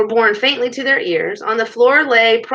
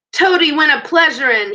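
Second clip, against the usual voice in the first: raised, higher-pitched taunting yell; 6 semitones higher, 4 dB louder than usual. A narrator's reading. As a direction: call it out as a battle taunt. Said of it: "he yelled".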